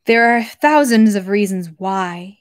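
slow line, landing firmly on "why"; emphasis on why. A narrator's reading. Count explaining himself to the princess, stressing "why". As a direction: draw it out and put special weight on "why". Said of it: "He placed special emphasis on the word why".

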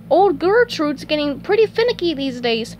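high, chatty cartoon voice; SpongeBob voice. Telling his co-worker about the grill, high chatty voice. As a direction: keep it high and swooping. Same chipper character continues.

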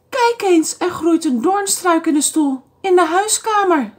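put-on storybook old lady, high and dismayed. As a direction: show him, as an upset old woman. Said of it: "zei de oude dame".

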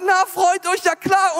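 high-pitched, delighted encouragement; excited cheering. Acted. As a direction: egg them on in a high, lively voice. God cheers on the new couple.

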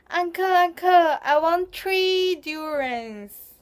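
"three" said as "tree", high, loud and slow; Singlish. Shown as a demonstration. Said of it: "pronouncing words like ch instead of ts".

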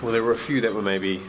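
ordinary delivery

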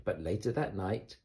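ordinary delivery, nothing marked

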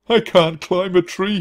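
groaning whine. A narrator's reading. Dizzy ranger groaning to the mouse about climbing. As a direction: groan it out in misery. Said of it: "he groaned".

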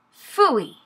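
high-pitched storybook "phooey"; disgusted growl. A narrator's reading. Little tiger watches baby be sick, mild disgusted growl. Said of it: "he growled".

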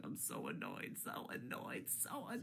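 seething hushed whisper. Real, not acted. Repeated venting.

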